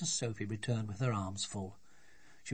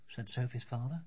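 ordinary delivery

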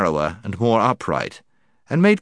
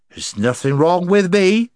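much higher, loud slurred insisting; drunken protest; 9 semitones higher, 4 dB louder than usual. Acted. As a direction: protest loudly, plainly drunk.

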